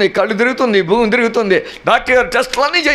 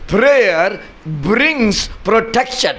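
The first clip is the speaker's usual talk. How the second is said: slow emphatic; much slower than usual. Shown as a demonstration. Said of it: "గట్టిగా చెప్ప-, అర్థమైందే చెప్పాలి".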